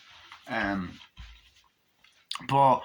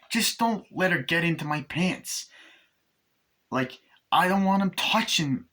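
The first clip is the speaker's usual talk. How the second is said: acted objection in a raised voice; high-pitched retelling; 6 semitones higher than usual. Retelling Chucky's demand about Tiffany, high-pitched mock protest.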